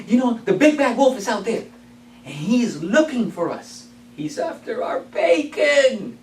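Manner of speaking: frightened pigs telling the story; scared pigs. Acted. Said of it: "and say".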